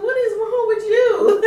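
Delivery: high, loud mock disbelief; retold incredulous cry. Eldest daughter laughing at crying mother, imitated incredulous shout.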